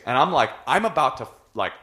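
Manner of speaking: ordinary talk, nothing marked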